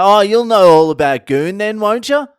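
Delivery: high, loud, rising mock-bogan holler; enthusiastic bogan tourists. Bogan tourists quizzing a fellow Aussie about goon, enthusiastic holler. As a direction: imitate an overexcited Aussie roping in a mate. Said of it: "They were at least enthusiastic".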